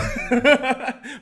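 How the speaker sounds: high burst of laughter; bright cackling laugh; real laugh. Real, not acted. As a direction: laugh out loud at his own joke. Laughs at own joke.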